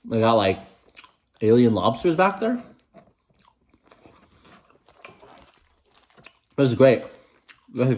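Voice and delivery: talking between chews; muffled by food in mouth; mouth full, chewing. Real, not acted. Seen 3: Bites egg roll at -2, then lips pursed and chewing throughout.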